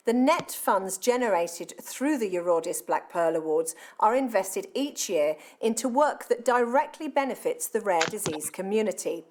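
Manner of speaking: ordinary delivery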